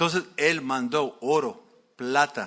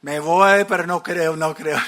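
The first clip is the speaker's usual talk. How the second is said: loud, sceptical muttering as he leaves; grudgingly going, not believing; doubtful grumble; 8 dB louder than usual. Retold or imitated. Naaman heading to the river unconvinced, loud doubtful grumble. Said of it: "yo puedo imaginarse él".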